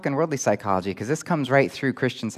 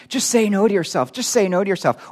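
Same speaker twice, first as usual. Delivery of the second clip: high, louder mocking chant of advice; mimicked sloganeering; 8 semitones higher, 3 dB louder than usual. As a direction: parrot it like a pat slogan. Mimics people repeating a slogan.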